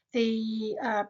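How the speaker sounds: ordinary talk, nothing marked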